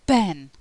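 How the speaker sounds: "ben" said alone, loud, voiced B at the start; teacher modelling "ben" clearly and firmly; minimal-pair demo. Shown as a demonstration. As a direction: isolate "ben" with a firm, clear B. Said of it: "the B sound".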